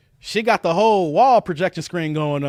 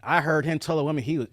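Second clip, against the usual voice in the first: ordinary talk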